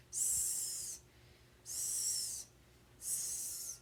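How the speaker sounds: a soft snake hiss. Shown as a demonstration. Teacher showing kids the snake sound, a hiss. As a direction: breathe out "S" like a snake. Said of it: "Sammy Snake sound".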